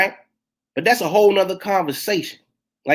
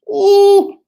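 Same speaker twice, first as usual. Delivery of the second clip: high ooh; 12 semitones higher, 8 dB louder than usual. Real, not acted. Exclaiming at irresponsible talk.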